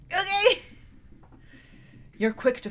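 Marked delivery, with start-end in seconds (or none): 0.1-0.6 squeaky okay. Acted. High squeaky reply.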